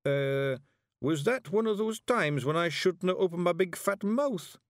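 high Scots pixie, unsure; just realised the blunder; sheepish Feegle. A narrator's reading. Daft Woolly asks Rob sheepishly in a high Scots voice. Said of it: "said Woolly".

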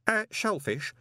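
ordinary delivery

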